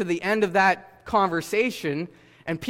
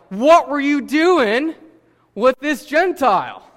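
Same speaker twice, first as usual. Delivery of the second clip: higher, louder, slow and indignant; loud accusing challenge; 7 semitones higher, 8 dB louder than usual. Retold or imitated. Jerusalem believers confront Peter about the Gentile, loud and indignant. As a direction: demand an answer like a scandalized critic. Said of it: "they start to criticize him".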